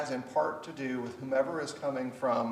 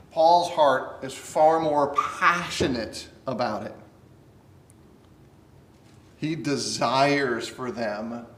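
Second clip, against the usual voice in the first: fervent preaching; 6 dB louder than usual. Acted. Preacher reading Paul's letter to the class, fervent and loud. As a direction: lean in and preach it like it matters.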